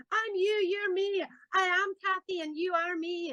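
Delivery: high-pitched mock fan gushing. A fan telling her they're one and the same, high excited gush. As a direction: imitate an overexcited Glasgow fan, way up high. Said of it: "people run down the street after me going".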